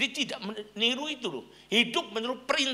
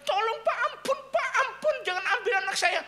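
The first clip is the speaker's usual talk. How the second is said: mock tearful begging, much higher; crying plea. Pastor imitating the crying mother on the phone, high wailing plea. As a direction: beg through pretend sobs, voice way up. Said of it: "sambil nangis-nangis".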